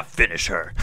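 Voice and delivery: low, deep finish her call; booming fighting game announcer. Retold or imitated. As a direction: growl it like the arcade announcer. Mortal Kombat finishing call.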